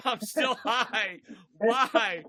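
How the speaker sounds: slow, laughing disbelief. Real, not acted. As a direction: laugh through the question. Laughing at his own state.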